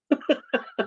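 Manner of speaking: short high laugh at his own warning; a quick laugh to close it out. Real, not acted. End of story laugh.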